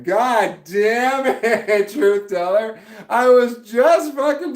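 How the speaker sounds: loud, high, drawn-out laughing curse; laughing frustration. Real, not acted. Streamer cursing a viewer for mentioning the game, laughing and loud.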